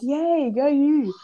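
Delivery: high, drawn-out phony cheer; fake cheer. Cheering a girl because her crush was there, fake enthusiasm. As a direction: cheer her on insincerely, stretching it out. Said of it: "I had to be fake".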